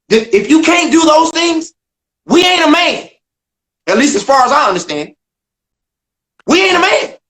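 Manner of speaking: heated, emphatic declaration; impassioned. Real, not acted. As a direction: drive the point home, voice climbing. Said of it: "impassioned point about manhood".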